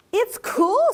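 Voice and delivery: high happy little frog. A narrator's reading. Young frog delighting in the pond in a high voice. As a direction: pipe it high and pleased. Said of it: "said one".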